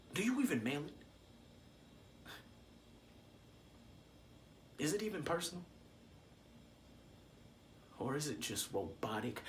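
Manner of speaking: very soft, low questioning; hushed hurt. Acted. Hurt questions to absent father.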